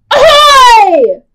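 very high, loud pirate shout; big ahoy shout. Acted. Storyteller shouts ahoy to the children. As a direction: holler it across the deck.

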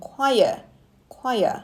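"choir" as kwai-yer, joined by a light y; linked syllables. Shown as a demonstration. Teacher explaining the linking sound to learners, linked two-syllable "choir". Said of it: "ใช้ยอยักษ์เชื่อมนิดนึง".

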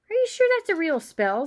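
high, loud sceptical schoolgirl; bossy, doubting question; bossy girl. A narrator's reading. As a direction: challenge it like a know-it-all. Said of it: "She had a bossy sort of voice".

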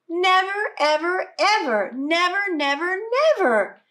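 stubborn refusal. Acted.

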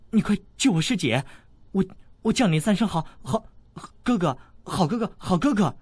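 put-on high, hurried, desperate girl; frantic pleading. A narrator's reading. Princess frantically begs the boy to save her sister. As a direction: rush it out, ready to say anything. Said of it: "小郡主大急，忙道".

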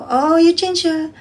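put-on high, bright call to the little boy; cheery greeting to child. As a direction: sing it out to the kid, lightly.

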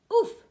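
loud high "oof" of effort; grunt. Acted. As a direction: grunt under a heavy load. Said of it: "grunted Douglas".

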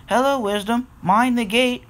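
high-pitched warning call; crying out. A narrator's reading. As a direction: call out brightly to a passing engine. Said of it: "cried Philip".

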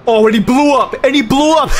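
high, loud cackling delight; real gloating laughter; gleeful laughing. Real, not acted. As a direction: laugh at the rival's crash. Rival crashes out.

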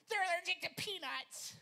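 high shrill-free mock screech of an angry mom; high, loud imitation of an angry woman; angry mother. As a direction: squeal it as the furious mother.

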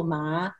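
"ma" rising like a question; tone two. Shown as a demonstration. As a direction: Mandarin second tone on "ma". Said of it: "tone two".

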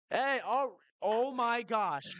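high, loud gasp of realization; oh no, I forgot; shocked oh my gosh; sudden realization. Real, not acted.

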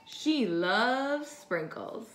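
ordinary delivery, nothing marked

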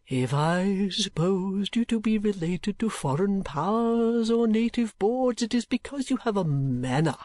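court a lady with grandiose, stretched words; pompous, humble old suitor; eccentric old gentleman. Acted.

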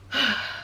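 a soft, weary sigh out through the mouth; heavy sigh. Real, not acted. Vlogger after saying kids make her break, soft real sigh. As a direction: breathe out the sadness, quietly.

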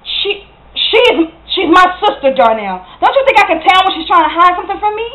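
heated, hurt ultimatum; angry accusation. Acted. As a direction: insist you know, then warn Darnell off. Acted quarrel, accusation and threat to leave.

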